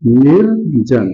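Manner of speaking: निर्जन stretched out slowly; slow sounded-out word. Shown as a demonstration. Teacher answers his own question, sounding out निर्जन slowly. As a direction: lean on each part of निर्जन. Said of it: "क्या बन जाएगा यहाँ पर?".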